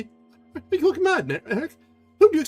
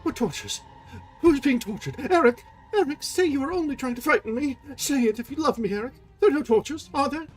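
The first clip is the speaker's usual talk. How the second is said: put-on much higher, frantic young woman; terrified pleading; 5 dB louder than usual. Christine begging Erik, terrified. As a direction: beg him to deny it.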